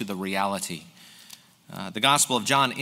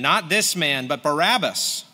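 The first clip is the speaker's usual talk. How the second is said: raised, louder crowd shout; a reader's raised crowd cry; 4 semitones higher, 4 dB louder than usual. Acted. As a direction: call it out as the angry crowd. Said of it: "They cried out again".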